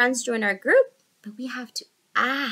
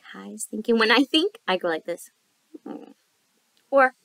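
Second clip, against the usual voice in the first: ordinary delivery, nothing marked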